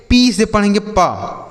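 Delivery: ordinary delivery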